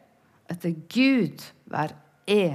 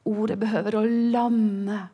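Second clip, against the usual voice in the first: normal talking voice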